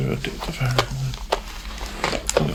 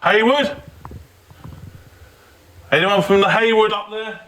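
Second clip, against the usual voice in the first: calling up to window; 6 semitones higher than usual. Real, not acted. Calling names up to upstairs.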